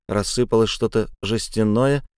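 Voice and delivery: ordinary delivery, nothing marked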